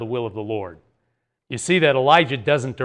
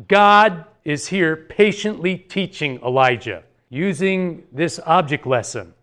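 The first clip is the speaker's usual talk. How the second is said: normal talking voice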